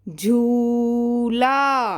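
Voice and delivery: very slow dictation of झूला; dictation word. Shown as a demonstration. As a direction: stretch झूला out syllable by syllable, very slow. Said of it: "पहला शब्द मैं बोलती हूँ".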